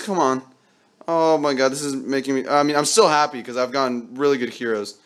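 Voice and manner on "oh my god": usual speaking voice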